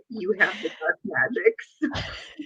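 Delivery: slow, soft mock-sinister witch voice; mock spooky witch. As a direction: play the dark sorceress for a joke. Teasing friend as a witch.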